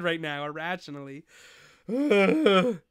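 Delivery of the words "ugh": frustrated groan. Real, not acted.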